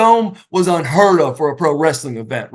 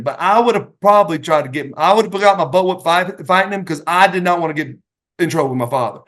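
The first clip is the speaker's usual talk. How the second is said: usual speaking voice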